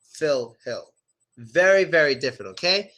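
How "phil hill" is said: "Phil, hill" dropped low, short ih; deep short i. Shown as a demonstration. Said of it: "deeper voice".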